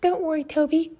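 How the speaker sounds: very high-pitched little engine voice; squeaky, high reassurance; Lady. Acted. Engine comforting crashed Toby, very high voice. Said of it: "cried Lady".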